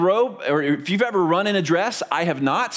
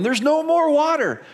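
higher, slow, hammed-up alarm; mocking storm hoarders, higher and slow; mock panicked shopper; 9 semitones higher than usual. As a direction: whine it like a frantic shopper. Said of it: "people before a storm are going".

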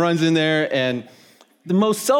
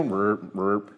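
low croaking "burp, burp" like a bullfrog; bullfrog croak imitation; 7 semitones lower, 6 dB quieter than usual. Pastor imitating bullfrogs for the congregation, low croak. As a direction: croak it out like a frog in the pond.

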